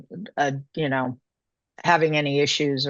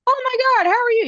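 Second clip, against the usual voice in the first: much higher and louder happy hello; delighted surprise greeting; excited greeting; 5 dB louder than usual. Retold or imitated. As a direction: squeal at running into an old friend. Surprise meeting friend on bus.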